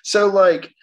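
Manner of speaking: ordinary talk, nothing marked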